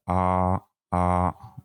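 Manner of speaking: "A" held low and open; vowel A demo. Shown as a demonstration. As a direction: model "A" with a wide open mouth. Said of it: "regardez bien la, la position de la bouche". ("A" prononcé bouche grande ouverte)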